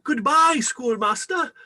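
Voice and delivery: high, loud, eager stammer; nervous boyish goodbye. Acted. Young Scrooge flustered bidding the schoolmaster goodbye. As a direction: blurt the goodbye.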